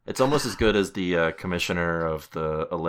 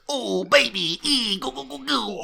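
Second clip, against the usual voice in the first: mob guy at strip club. Retold or imitated. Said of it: "he's like".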